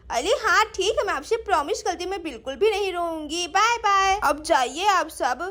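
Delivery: put-on small child earnestly promising; lisping kiddie voice, giving in sweetly; agreeable little girl. (मान जाती छोटी बच्ची की आवाज़)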